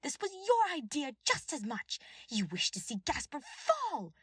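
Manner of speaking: raging. A narrator's reading. Cornelia blaming Lord Clemen, raging. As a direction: rage at the betrayer. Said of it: "face red with rage".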